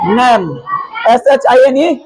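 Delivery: ordinary talk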